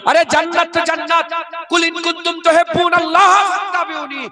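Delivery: high, ringing Quran recital; full-force preaching. Acted. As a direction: cry the verse out to the crowd.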